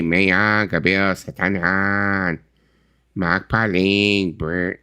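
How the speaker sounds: slow put-on Filipino accent; Filipino accent read. Shown as a demonstration. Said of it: "Can you read that in an accent?".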